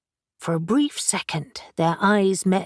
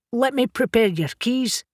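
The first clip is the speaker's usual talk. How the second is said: put-on thick Scottish accent, a touch higher; 6 semitones higher than usual. A narrator's reading. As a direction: speak it in a thick Scottish brogue. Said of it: "he said in a thick Scottish accent".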